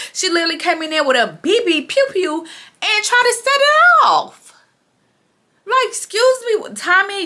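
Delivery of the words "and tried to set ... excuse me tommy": outraged disbelief. Real, not acted. Vlogger outraged at Tommy's gun stunt, high incredulous voice. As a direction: let the disbelief climb way up high.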